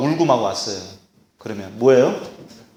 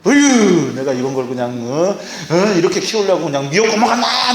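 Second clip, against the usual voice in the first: exasperated scolding parent; 2 semitones higher than usual. Acted. Parent lamenting how the child turned out, hammed-up exasperated scolding. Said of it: "그래도 한참 혼내고 나면".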